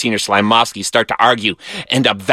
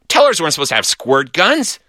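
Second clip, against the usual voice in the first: ordinary delivery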